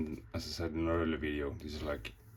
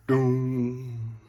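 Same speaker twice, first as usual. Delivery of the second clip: high, loud booming bell note; doom bell boom; 8 semitones higher, 10 dB louder than usual. Retold or imitated. Bell ringer tolling the death bell, booming.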